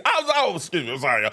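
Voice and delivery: usual speaking voice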